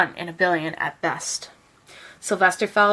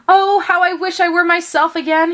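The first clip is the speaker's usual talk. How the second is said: higher, louder, pleading cry; longing wish; 8 semitones higher, 4 dB louder than usual. A narrator's reading. Donkey trapped as a rock, longing and pleading. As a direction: ache to be a donkey again. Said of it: "he thought".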